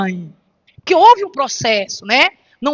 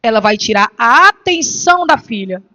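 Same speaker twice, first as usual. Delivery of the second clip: normal talking voice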